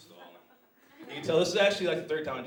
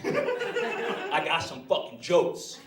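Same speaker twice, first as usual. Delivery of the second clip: slow, forceful mock threat; menacing gunman comic; 6 dB louder, much slower than usual. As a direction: order the crowd to laugh, word by word. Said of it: "on the stage with a gun".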